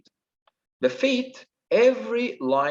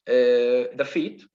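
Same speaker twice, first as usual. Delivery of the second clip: ordinary talk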